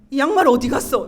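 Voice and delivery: loud, annoyed demand of a retold husband; angry. Husband home demanding his socks, acted anger. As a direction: snap it out loudly, mildly acted anger. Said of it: "화를 막 내는 거예요".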